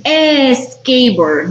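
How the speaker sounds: "Es skateboard" with an added E before the S, as a mistake; teacher mocks students saying "Es skateboard"; wrong E sound added. Shown as a demonstration. Teacher imitates the wrong "Es skateboard", slowly. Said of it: "No dicen".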